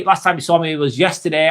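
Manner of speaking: ordinary talk, nothing marked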